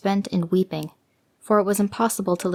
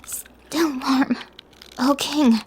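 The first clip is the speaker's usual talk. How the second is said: higher, faint gasping words; 6 semitones higher, much slower than usual. Acted. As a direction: force it out, nearly frozen. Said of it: "she could just gasp".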